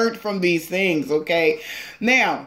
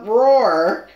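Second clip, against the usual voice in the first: a playful little roar, no louder; mock lion roar; 5 dB louder than usual. Reader urges Leo to be the lion, light mock roar. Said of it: "You're the lion, remember?".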